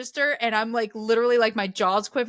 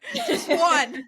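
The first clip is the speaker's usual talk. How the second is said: laughing; 8 semitones higher, 5 dB louder than usual. Real, not acted. Joking plea for a pepper.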